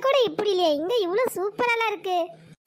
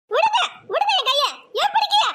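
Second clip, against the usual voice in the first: much higher, louder protest while grabbed; shrieking struggle; 8 semitones higher, 3 dB louder than usual. Acted. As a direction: squeal to be let go. Grandma struggling with the child.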